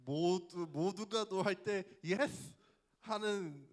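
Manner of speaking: slow, emphatic recited commercial line; ad slogan. Retold or imitated. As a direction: spell out the old TV catchphrase word by word. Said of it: "티비 광고에 어떤 광고가 있었냐면".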